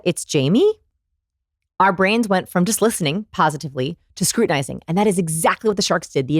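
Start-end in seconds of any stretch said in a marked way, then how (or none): 0.0-0.8 "Jamie" swung upward like a question; question inflection. Retold or imitated. Replaying the founder's weak introduction, questioning rise. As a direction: ask "Jamie" rather than state it. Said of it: "when he said".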